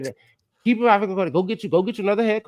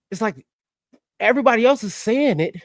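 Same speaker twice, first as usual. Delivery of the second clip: usual speaking voice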